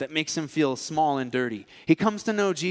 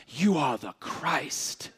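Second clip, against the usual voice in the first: dramatic; 4 dB quieter, much slower than usual. Retold or imitated. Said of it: "this dramatic".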